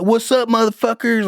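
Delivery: high, swaggering greeting; cocky arrival shout. Retold or imitated. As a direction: reinforcements jumping in full of bravado. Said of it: "he's like".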